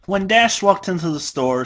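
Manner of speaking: ordinary talk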